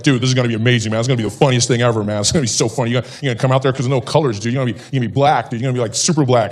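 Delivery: lightly voiced American dude; American bro voice. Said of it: "My American friends were laughing at me like".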